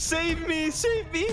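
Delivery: high panicked plea for help; squeaky mock panic; begging. Fleeing the attacker, begging.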